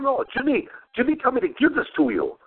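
delivery man pushing the soup on a customer, light mock voice; imitating delivery guy. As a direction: insist politely at the door. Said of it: "he goes".

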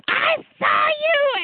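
high, slow, taunting yell from a tall schoolgirl; mock shouted taunt. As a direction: belt it out like a girl catching you trip. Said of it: "points down at me and goes".

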